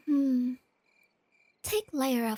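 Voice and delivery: ordinary talk, nothing marked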